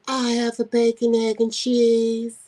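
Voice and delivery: put-on high, slow, light girly voice. Husband mocked ordering food, high and slow light voice. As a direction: mimic husband's teeny light voice. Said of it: "this little teeny little light in the ass voice".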